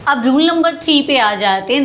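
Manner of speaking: ordinary delivery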